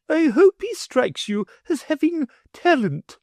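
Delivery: high, lofty noblewoman; a proud mother fishing for praise; grand lady voice. A narrator's reading.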